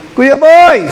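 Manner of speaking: loud call across the room; hailing Kuya Boy. Retold or imitated. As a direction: shout his name to get attention. Member calling out to Kuya Boy.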